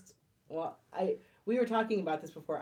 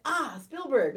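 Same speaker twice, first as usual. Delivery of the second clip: higher, louder blurt of a sudden idea; mock sudden discovery; 6 semitones higher, 8 dB louder, slower than usual. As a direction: pretend the name just popped into your head. Acts out a studio's sudden pick.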